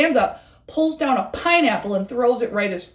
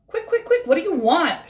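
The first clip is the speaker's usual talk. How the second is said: very high, hurried fox voice; impatient fox; 6 semitones higher than usual. Acted. Busy Fox brushes off the frog, very high and impatient. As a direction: rattle it off with no time to spare. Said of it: "doesn't have time to be bothered".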